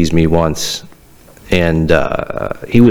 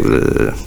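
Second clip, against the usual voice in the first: exasperated groan; 4 dB quieter than usual. Retold or imitated. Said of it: "I was just like".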